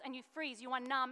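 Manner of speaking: ordinary delivery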